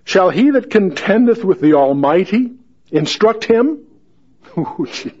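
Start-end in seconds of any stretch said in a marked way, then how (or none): 0.0-3.8 raised, formal Bible reading; lifted King James reading voice; scripture reading. Acted. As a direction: read it out like the pulpit. Reading God's words.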